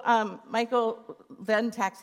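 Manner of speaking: ordinary talk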